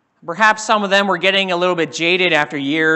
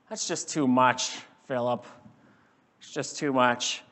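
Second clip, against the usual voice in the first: dismissive; 5 semitones lower, 7 dB quieter than usual. Acted. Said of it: "You can almost hear Nathanael saying".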